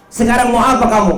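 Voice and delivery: high, impatient challenge; exasperated demand. Acted. Jibril asking the Prophet what he wants now, exasperated. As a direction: demand an answer, fed up.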